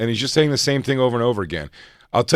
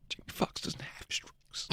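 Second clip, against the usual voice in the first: slow breathy whisper of denial; 18 dB quieter, slower than usual. Retold or imitated. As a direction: whisper it slowly to yourself. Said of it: "he just goes".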